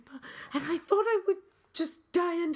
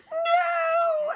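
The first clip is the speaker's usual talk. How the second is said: high shrieked no in mock panic; horrified no; 6 dB louder than usual. Realising she must meet the emissary, in a mock shriek. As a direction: shriek it in comic horror. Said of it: "And I was like".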